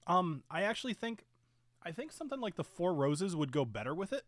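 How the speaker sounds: usual speaking voice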